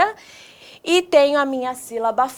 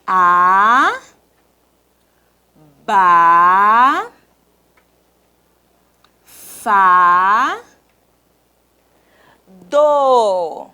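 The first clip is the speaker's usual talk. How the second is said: each of "A, ba, fa, do" held long, long pauses; dictated syllables; 4 semitones lower, 5 dB louder, much slower than usual. Shown as a demonstration. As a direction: swoop up on "A, ba, fa", settle on "do". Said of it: "Vamos escrever?".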